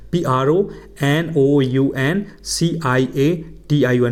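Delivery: letters spelt out slowly; slow spelling. Shown as a demonstration. Teacher spells the word aloud for students. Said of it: "pronunciation".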